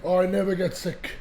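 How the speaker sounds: ordinary talk, nothing marked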